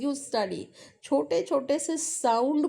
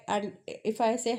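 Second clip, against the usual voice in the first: normal talking voice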